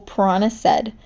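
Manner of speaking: ordinary delivery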